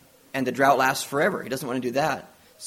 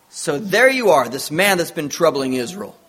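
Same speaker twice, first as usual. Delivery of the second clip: accusing; 3 semitones higher, 7 dB louder than usual. Retold or imitated. King Ahab confronts Elijah over Israel's trouble, accusingly. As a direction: act out a king pouncing on his troublemaker.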